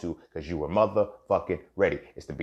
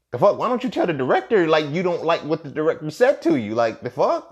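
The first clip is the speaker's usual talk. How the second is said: high, loud disbelieving challenge; incredulous outburst; 8 semitones higher, 5 dB louder than usual. Retold or imitated. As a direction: retell it as an exasperated outburst. Acted reaction to friend's story.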